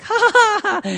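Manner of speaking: high, loud hammed-up laughter; mock joyous laugh. Preacher mimics a happy convert, hammed-up laugh. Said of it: "It's pretty joyous when they're like".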